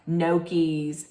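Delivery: "gnocchis" as NOH-keez, no y glide; slow demo of "gnocchis", NOH-keez; common mispronunciation. Shown as a demonstration. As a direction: say "gnocchis" with a plain n and long oh. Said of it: "most people would say it".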